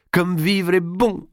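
high, slow, elated exclamation; blissful exclamation. Acted. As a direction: savour the joy with a full heart. Happy lover savours being alive.

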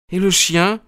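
high female character voice; acted high, worried woman; anxious question. A narrator's reading. Anxious woman asks about dog.

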